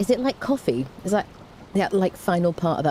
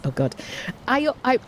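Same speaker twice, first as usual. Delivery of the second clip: ordinary talk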